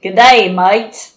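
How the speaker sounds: loud, broad Australian "mate"; punchy mock Australian greeting; Australian accent. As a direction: put on an Australian accent, loudly. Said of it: "with an Australian accent".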